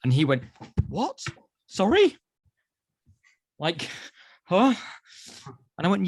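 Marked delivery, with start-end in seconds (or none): 0.9-2.2 put-on high, baffled little-boy voice; imitated startled child. Imitating his son hearing Dad agree, taken aback. 4.4-4.7 high, loud puzzled huh; imitated startled child. Imitating his son caught off guard by Dad, startled. Acting son's startled reaction.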